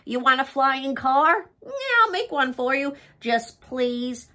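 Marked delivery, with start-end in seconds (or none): none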